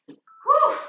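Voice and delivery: very high, loud gasping whew; winded exhale. Real, not acted. Instructor to class after the round, loud winded whew. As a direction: blow it out like you just finished sprinting.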